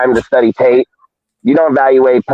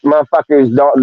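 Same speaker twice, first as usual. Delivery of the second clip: ordinary delivery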